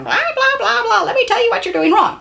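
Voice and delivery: high, fast, laughing mock lecture; mock nagging. Wife mocking how not to correct her husband, high laughing nag. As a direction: rattle it off like a know-it-all wife, with a laugh.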